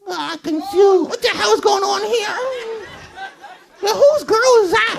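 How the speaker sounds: loud, bewildered dummy voice; confused puppet shout. Acted. Dummy confused about who is dating whom, in a loud puppet voice. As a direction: yell it out as the baffled dummy.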